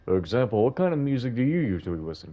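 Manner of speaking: put-on old man. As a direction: speak as a gentle elderly gentleman.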